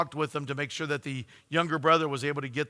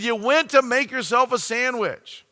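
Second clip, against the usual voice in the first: higher, louder, with irritation; annoyed retort; 10 semitones higher, 7 dB louder than usual. Retold or imitated. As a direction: snap it back at her, annoyed. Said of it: "with a little bit more, uh, annoyance".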